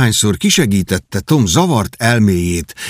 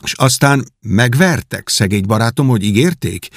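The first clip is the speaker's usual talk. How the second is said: ordinary delivery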